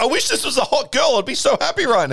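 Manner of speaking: hammed-up, high-pitched lament; mock-pleading alien. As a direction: whine it high for laughs. Jokey voice for embarrassed alien.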